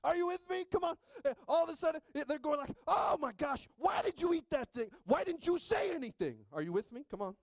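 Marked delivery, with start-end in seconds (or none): 2.9-6.4 high, loud nagging complaint; hammed-up marital blame; mock quarreling spouse. Spouse blaming partner on the way to church, high and loud. Adam and Eve quarrel retold.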